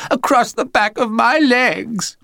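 high, slow fake-pitiful child; milk it like a sly little actor; whimpering. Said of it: "whimpered Tristram".

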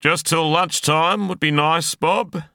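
high, loud shout over the wind; strained high-pitched yell. A narrator's reading. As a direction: yell it back over the noise, pitched right up. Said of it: "he yelled".

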